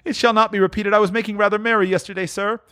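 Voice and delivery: high, hurried apology; pleading clerk. Acted. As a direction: plead your excuse in a high voice. Bob pleads with his boss.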